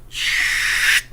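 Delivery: unvoiced hush; a quick instinctive shh; whispered shh while pushing the box away; shushing. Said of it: "I went".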